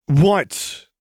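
sharp high indignant "What?"; indignant huff. A narrator's reading. Lux huffs at laughing Jinx, indignant. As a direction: huff it out, offended. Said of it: "Lux huffed".

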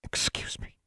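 very soft, menacing whisper; ominous near whisper. A narrator's reading. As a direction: breathe it as a quiet threat. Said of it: "in an ominous near whisper".

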